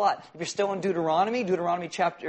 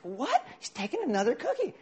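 indignant surprise; 7 semitones higher than usual. Retold or imitated. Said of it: "She's like".

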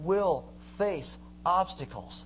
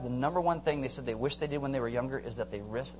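ordinary delivery

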